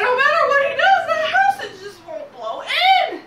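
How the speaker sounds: high, loud, fast outburst; exasperated storytelling. A narrator's reading. Storyteller on the house not budging, loud exasperation. Narrator at wolf's failed effort.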